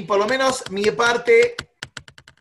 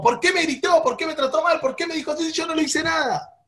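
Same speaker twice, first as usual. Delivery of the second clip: high-pitched indignant protest; mock outrage, pitched well up; acted indignation; 9 semitones higher than usual. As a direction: fire off aggrieved questions, acting it out. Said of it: "me enojo".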